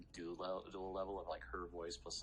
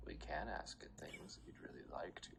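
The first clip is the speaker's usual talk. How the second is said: whispering. Acted. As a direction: breathe it secretly. GM whispering Syndra's lines.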